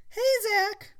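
put-on young woman voice. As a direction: greet him like a sweet girl. Phoebe voiced.

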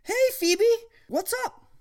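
put-on nervous teen boy. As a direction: act caught and flustered. Zach greets nervously.

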